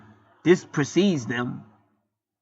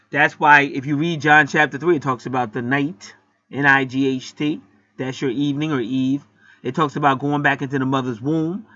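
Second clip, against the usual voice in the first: ordinary talk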